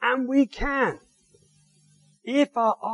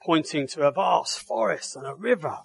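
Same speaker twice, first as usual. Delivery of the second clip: ordinary talk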